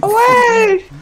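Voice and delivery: very high mock shout of Get away; Bloodborne hunter shout. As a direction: yell it like a game hunter. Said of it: "come quelli di Bloodborne". (grido acutissimo da cacciatore)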